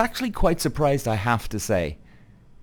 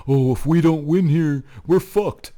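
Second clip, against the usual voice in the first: mock dramatic; 5 semitones higher, 5 dB louder than usual. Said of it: "Like, oh, give me a break".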